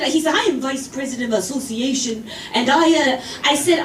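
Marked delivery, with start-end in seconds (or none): 0.3-2.2 put-on Russian old man. Old man claims authority.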